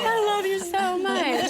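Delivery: high, slow mock-crying declaration; retold tearful outburst. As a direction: gush as if choked with happy tears. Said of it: "now I'm crying".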